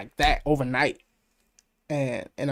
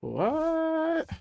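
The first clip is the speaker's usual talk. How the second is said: high, stunned what; shocked; 13 semitones higher, 6 dB quieter than usual. Real, not acted. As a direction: gasp it out in disbelief. Shocked reaction to news.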